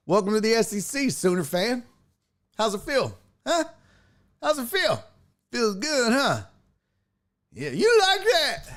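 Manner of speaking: high-pitched mock welcome, rubbing it in; gloating taunt. Taunting imagined rival fans.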